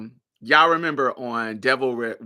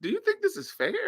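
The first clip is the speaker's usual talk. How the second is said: much higher, softer pointed question; imitating Elphaba; 14 semitones higher, 5 dB quieter, faster than usual. Elphaba asking Glinda about the room, higher pointed question. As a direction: ask it with quiet edge. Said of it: "Elphaba says".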